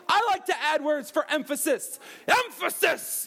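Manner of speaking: loud, high-pitched sitcom outburst, punching EMPHASIS; Josh impression. Youth pastor imitating a Drake and Josh character, high loud impression. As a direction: exclaim it high and big like a TV character. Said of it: "So Josh was always like".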